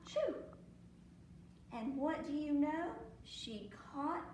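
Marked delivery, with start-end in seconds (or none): 0.1-0.4 gasp, then high, loud "choo"; mimed sneeze. A narrator's reading. Storyteller as the cat catching cold, mimed high sneeze. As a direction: act out the cat's sneeze. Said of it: "the sneeze".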